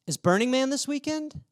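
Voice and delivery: put-on high-pitched girl's question, rising; mimicking a girl, pitched well up; imitated girl. Girl's question voiced in story.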